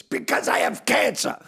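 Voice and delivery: high, loud punchline delivery; joke horse's punchline. Acted.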